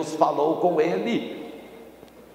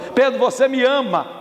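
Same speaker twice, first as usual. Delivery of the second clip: high, pressing asking; insistent question; 7 semitones higher than usual. Acted. Jesus questions Peter a third time, insistent. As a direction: press the question with raised pitch.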